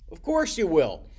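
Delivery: usual speaking voice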